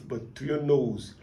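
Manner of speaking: ordinary talk, nothing marked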